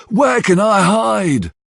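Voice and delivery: high, slow, frightened call; panicked cry. Acted. Bill realises he has nowhere to hide, panicked high cry. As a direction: cry out like a hunted man. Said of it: "says Bill".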